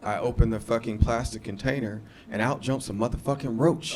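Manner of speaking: ordinary delivery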